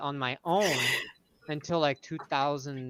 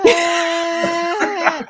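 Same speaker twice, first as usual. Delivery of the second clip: high loud mock scream of a little girl; a little girl screaming at the chocolate hand; imitated child's wail; 14 semitones higher, 12 dB louder than usual. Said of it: "she starts crying".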